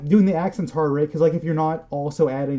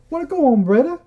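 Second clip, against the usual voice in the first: high, loud, hammed-up Jamaican accent; 11 semitones higher, 3 dB louder, slower than usual. Shown as a demonstration. Showing off to a friend his best attempt, hammy Jamaican accent. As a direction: belt out your best Jamaican accent, pitched way up. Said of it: "my best Jamaican accent".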